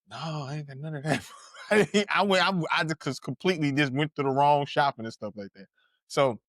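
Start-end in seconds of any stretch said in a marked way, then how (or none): 0.1-1.3 small, soft, quick reply with a laugh; mock-meek mumble, much softer; sheepish admission. Roasted kid answering his classmates, sheepish and quiet.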